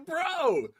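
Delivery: high squeaky grow up; exasperated scolding. Retold or imitated. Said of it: "And it's like".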